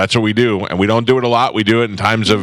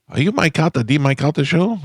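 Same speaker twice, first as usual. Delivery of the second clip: higher, put-on accent of Marie; imitating Marie's accent; 6 semitones higher than usual. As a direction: mimic a woman's lovely accent, higher. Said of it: "she's got a lovely accent".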